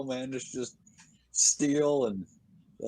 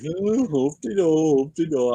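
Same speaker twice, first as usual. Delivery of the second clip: higher, sing-song hoop-de-do; mock carefree; 4 semitones higher, 5 dB louder than usual. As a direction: tease a careless driver. Mocking careless drivers.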